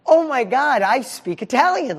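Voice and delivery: mock thrill of thinking he speaks Italian; excited inner thought. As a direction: burst out with delight at yourself. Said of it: "I'm like".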